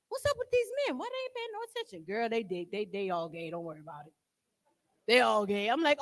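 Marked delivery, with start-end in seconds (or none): 0.1-2.0 high, fast whiny complaint; puzzled daughter's high whine. Retold or imitated. Daughter complaining to her mother, high and whiny. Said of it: "So I asked my mother". 2.0-5.7 low, dismissive mother; lightly voiced mom brushing it off; mother's reply. Mother reassuring her daughter, low and dismissive. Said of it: "My mother just as crazy as me".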